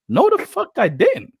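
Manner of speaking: high, indignant protest, voice climbing; mock indignant denial. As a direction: squeal out an outraged denial. Kendra denying angrily, voiced quote.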